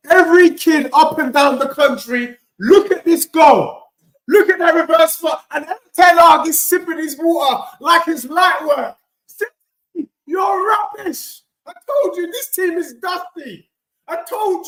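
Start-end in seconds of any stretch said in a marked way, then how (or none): 0.1-9.0 louder, higher hype; hyped praise. Real, not acted. Streamer raving to viewers about the reverse pass, hyped. Hyping the goal. 9.3-13.7 high taunting shout; mocking shout. Real, not acted. Fan jeering at rival City supporters, taunting shout.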